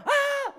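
put-on very high, loud wailing baby cries; piercing mock infant wail; imitated baby cry. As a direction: wail like a newborn demanding the bottle. Baby crying again on the hour.

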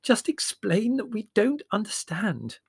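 high, slow, breathless plea. A narrator's reading. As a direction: urge it while out of breath. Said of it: "said Mother breathlessly".

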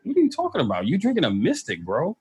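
high incredulous what are you talking about; a hint of mock outrage; mock disbelief. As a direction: scoff at them, voice jumping up. Mock retort to clowning friends.

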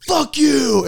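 put-on high, loud drunk yelling at the field; retold yelling. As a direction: yell it from the front row like a drunk fan.

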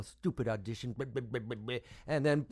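usual speaking voice

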